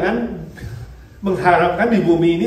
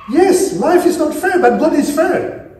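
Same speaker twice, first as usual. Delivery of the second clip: preaching emphasis; 10 semitones higher, 5 dB louder, slower than usual. Acted.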